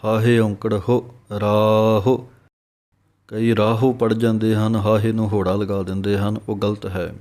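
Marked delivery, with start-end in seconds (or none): 1.4-2.2 ਰਾਹ with a long aa and a light u ending. Shown as a demonstration. Teacher shows the correct ਰਾਹ to students. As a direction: draw out ਰਾਹ gently. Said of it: "ਰਾਰੇ ਦੇ ਕੰਨੇ ਨੂੰ ਲੰਮਾ ਬੋਲ ਕੇ".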